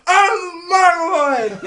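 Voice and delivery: high, loud, drawn-out caricature yell; imitated exuberant announcement. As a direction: holler it slowly as a proud announcement. Peter's proud shout acted out.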